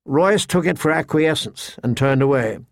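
usual speaking voice

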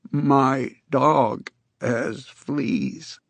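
very slow, careful, lightly rough voice. Acted. Con tries the test line again for Jacobs, clearer. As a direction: say it slowly as the voice comes back. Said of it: "the words were clearer now, and more human".

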